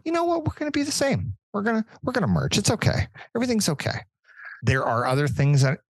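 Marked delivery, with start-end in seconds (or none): 0.0-4.0 very high, airy mock-reassuring voice; mocking quote. Angry fan mimics commissioner excusing the merger, high mock-soothing voice. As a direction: sneer it out high and falsely soothing. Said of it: "And then go".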